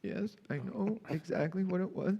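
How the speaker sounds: put-on high, soft guilty kid; the boy owning up to Santa; meek child. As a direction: confess it small and high. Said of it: "he was like".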